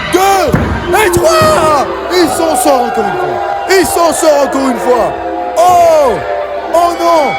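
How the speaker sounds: high-pitched hype over the finish; wrestling commentator excitement. Acted. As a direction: call it like the crowd's on its feet. Commentator hyped by surprise finish.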